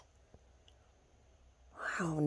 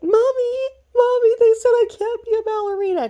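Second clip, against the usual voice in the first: put-on very high, loud little-girl whine; hammed-up squeal of a tattling child; mock wailing child; 9 dB louder than usual. Daughter complains to Mommy about ballet, mock wailing child.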